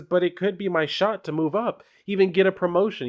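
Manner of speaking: high, bright-eyed keen voice; she's too keen on the ticket to leave; eager young worker. Acted. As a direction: Doyle brushing off Morgan, pitched well up. Doyle voiced in comic reading.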